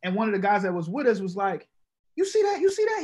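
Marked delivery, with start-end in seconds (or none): 2.1-3.0 much higher, urgent "you see that"; excited friend. Retold or imitated. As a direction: nudge your buddy, eager and pitched way up. Friend alerting him in club.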